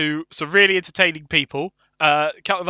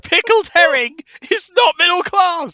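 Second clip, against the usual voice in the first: high, emphatic protest; indignant outburst; 11 semitones higher, 4 dB louder than usual. Real, not acted. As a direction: argue it as an outrage. Heated mock argument over class.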